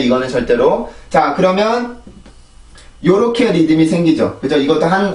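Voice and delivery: normal talking voice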